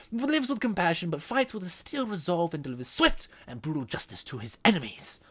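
theatrical champion introduction, punching key words; dramatic announcer. Acted. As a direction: announce the hero with grand flourish. Introduces game character dramatically.

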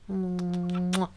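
ordinary talk

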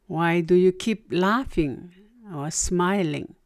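normal talking voice

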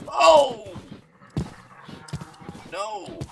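loud high alarmed cry; loud panicked no; startled shout. Real, not acted. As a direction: shout in sudden fright. Attacked by mob.

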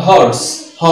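"হর্স" with the H sounded, then "হ" alone. Shown as a demonstration. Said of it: "এইচ এর উচ্চারণটা হচ্ছে". ("হর্স, হ" এইচ উচ্চারণ করে)

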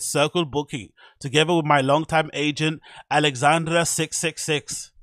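hammed-up German accent, stiff and formal. Vlogger reading a DJ's caption in a German accent. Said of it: "but my German accent".

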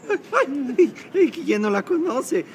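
high, fluttery squeals of admiration; fawning fan gush. Acted. Disguised man flattering famous singer Diana, high fangirl gush. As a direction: gush like a starstruck lady fan.

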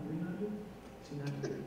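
soft, calm counting down under the breath. Retold or imitated. Counting to stay calm while the cop talks smack, soft and even. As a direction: count quietly to keep your cool. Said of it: "I'm just real calm going".

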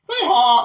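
high, loud donkey hee-haw; horse-laugh guffaw. Retold or imitated. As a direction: bray out a mock guffaw. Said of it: "Like a horse laughing".

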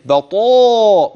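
بطاء slow with a full emphatic taa and a long aa; heavy taa. Shown as a demonstration. Teacher exaggerating the emphatic taa for students. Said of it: "وانتبه للمد الذي بعد ذلك".

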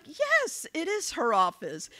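high-pitched, put-on receptionist reply; feigned politeness. Candidate hiding her identity from caller, high put-on politeness. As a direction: answer high and innocent, hiding who you are.

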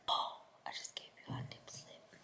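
hushed whisper to the camera. Real, not acted. Vlogger whispering before bed to viewers. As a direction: breathe the words so no one hears. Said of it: "This quality is crazy".

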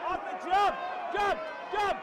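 loud urgent ringside yells; yelling at fighter. Real, not acted. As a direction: shout the instruction at him, over and over.

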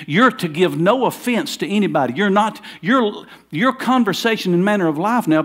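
high and loud, pounding each phrase; a preacher urging the congregation to rejoice, full force; preaching at full force. Real, not acted. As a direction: preach it out to the whole room.

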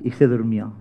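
usual speaking voice

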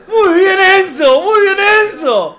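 high, drawn-out cheer; cheering a player. Real, not acted. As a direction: call out praise in a high voice. Alienta a un jugador. (aliento agudo y estirado)

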